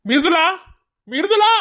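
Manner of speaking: very high, loud shouted name; angry calling out. Acted. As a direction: bellow her name across the house. Calling wife through the house. (கோபமாக பெயரைக் கூப்பிடுதல்)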